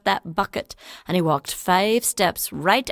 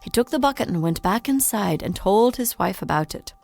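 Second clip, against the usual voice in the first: ordinary delivery, nothing marked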